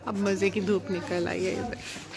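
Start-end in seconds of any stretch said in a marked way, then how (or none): 1.5-2.0 soft unvoiced whisper. Real, not acted. Owner whispering to viewers while the cat plays. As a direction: whisper so the cat isn't disturbed.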